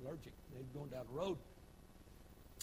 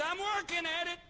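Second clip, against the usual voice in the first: higher, louder put-on voice of an excuse-maker; mock churchgoer; 11 dB louder than usual. Churchgoer answering the preacher, mock higher voice. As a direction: play the fidgety believer.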